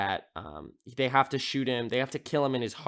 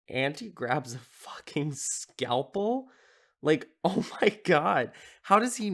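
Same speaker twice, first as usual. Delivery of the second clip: incredulous; 4 semitones higher, slower than usual. Retold or imitated. Her own disbelief, retold.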